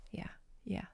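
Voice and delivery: breathy unvoiced whisper. Mavis confiding about her ghost, whispered. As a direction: whisper it like a secret.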